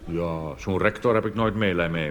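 normal talking voice

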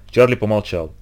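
usual speaking voice

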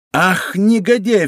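D'Artagnan cursing the treacherous lackey; angry exclamation. Acted. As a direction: spit out the insult in fury. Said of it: "вскричал Д'Артаньян".

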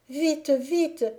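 high, loud urgent cry; fleeing girl pleading; panicked plea. Acted. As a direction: call for help in alarm. Zingora begs for escape.

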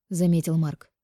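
ordinary delivery, nothing marked

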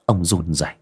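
ordinary delivery

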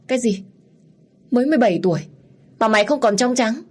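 a shocked father cannot believe his ears; shocked outburst. Acted. Father shocked at daughter's confession, loud disbelief.

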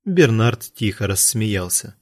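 usual speaking voice

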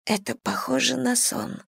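soft, trembling, close to tears; breaking whisper. A narrator's reading. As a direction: whisper it with the voice catching. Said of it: "срывающимся голосом прошептала".

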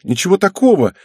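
quick high-pitched denial; dismissive daughter. A narrator's reading. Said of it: "отмахнулась Мизуки".

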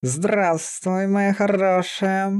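high, slow growly drawl; affectionate growling hello; beast-man's fond greeting. A narrator's reading. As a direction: stretch it out fondly in a beast voice. Beast-man greets his dear girl.

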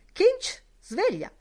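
high, loud call to rouse him; wake-up shout. A narrator's reading. Buck Mulligan shouting Kinch awake for breakfast, loud and high.

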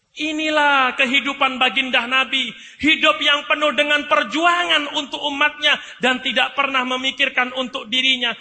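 ordinary talk

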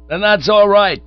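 hearty. Acted. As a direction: beam it out satisfied. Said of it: "said the chappie heartily".

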